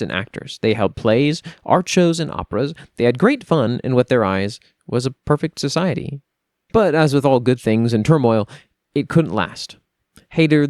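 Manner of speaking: grand storytelling read of a fantasy chronicle; epic lore narration. A narrator's reading. Reader narrating lore to listeners, grand and steady. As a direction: tell the legend like an old chronicle.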